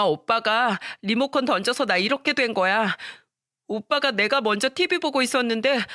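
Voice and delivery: put-on high, tearful child whining to mom; crying complaint. Hurt daughter begging mom to scold her brother, crying. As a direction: plead through tears like a hurt young girl. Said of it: "엉엉 울면서 이야기를 했다고".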